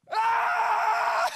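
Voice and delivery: high, loud panicked scream; terrified scream. Acted. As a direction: let out a servant's shriek of terror. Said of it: "this guy goes".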